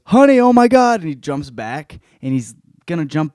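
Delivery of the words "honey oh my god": high, loud mock alarm; a dad hamming up a scare to prank his daughter; fake panic. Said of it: "and he goes".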